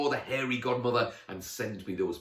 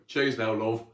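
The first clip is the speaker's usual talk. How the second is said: loud, friendly Geordie thanks; thick Geordie accent; 2 semitones higher, 8 dB louder than usual. Acted. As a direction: toss it off in broad Geordie. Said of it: "in a thick Geordie accent".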